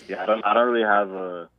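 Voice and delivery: usual speaking voice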